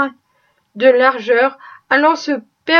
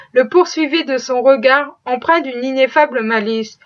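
plain reading voice

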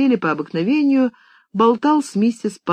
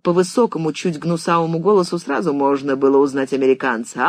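ordinary delivery, nothing marked

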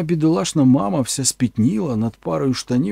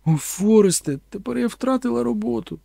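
a touch of tearful lament, higher; crying; 8 semitones higher than usual. Acted. Mother laments to her son, lightly tearful. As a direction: wail over the lost job. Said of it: "Мама плакала".

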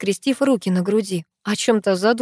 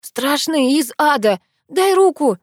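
high, urgent snap; frantic order, rising to a yell; barked demand; 7 semitones higher, 3 dB louder, slower than usual. A narrator's reading. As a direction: bark it at a friend in fright. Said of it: "рявкнула я".

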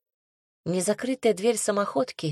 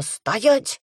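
sharp barked order; barked command; 4 semitones higher than usual. A narrator's reading. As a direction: bark the order. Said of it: "гаркнула я".

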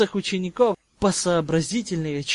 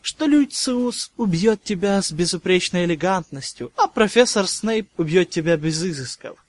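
ordinary delivery

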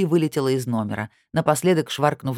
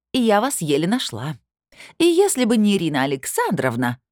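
agitated complaint; 6 semitones higher than usual. A narrator's reading.

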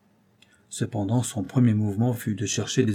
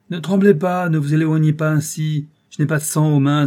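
beaucoup plus fort et plus aigu; il la retient d'une voix exaltée; exaltation fiévreuse; 6 semitones higher, 9 dB louder than usual. A narrator's reading. Comte effraie la comtesse. (le comte exalté, plus fort et plus aigu)

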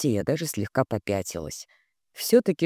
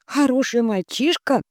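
incredulous; 11 semitones higher than usual. A narrator's reading. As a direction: echo хороший as an unbelieving question. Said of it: "мои брови не выдержали". (недоверчиво: хороший?)

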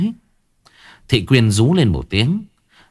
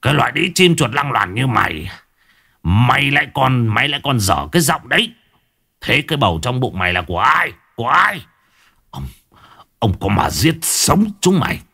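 hissing rage, climbing higher; mild angry hiss; 3 semitones higher than usual. Acted. Husband berating his unfaithful wife, enraged. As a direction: spit the insults at her. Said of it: "Lão rít lên".

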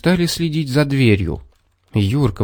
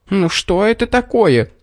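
high, exasperated exclamation; indignant fox; 10 semitones higher than usual. A narrator's reading. Fox has had enough of the bear, indignant. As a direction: protest in a high voice. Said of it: "возмутилась лисица".

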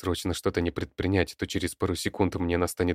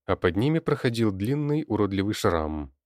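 plain reading voice

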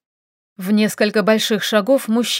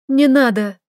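urgent, louder plea; pleading shout; 4 semitones higher, 5 dB louder than usual. A narrator's reading. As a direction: hold him back with your voice. Girl stops the fight.

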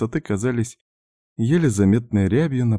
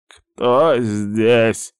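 put-on wary creaking old man; 3 semitones higher, 4 dB louder than usual. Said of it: "настороженно проскрипел старик".

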